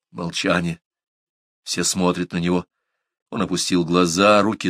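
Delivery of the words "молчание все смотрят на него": usual speaking voice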